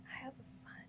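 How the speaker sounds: repeated in a soft whisper; whispered confession about her son. Real, not acted. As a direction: breathe it out like a confession.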